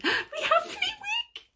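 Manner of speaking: high laughing squeal of delight. Real, not acted. Finding Flitwick in the calendar, high delighted squeal. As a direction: squeal his name like a thrilled fan. Finds favourite figure in calendar.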